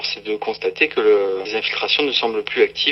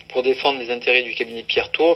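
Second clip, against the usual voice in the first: ordinary talk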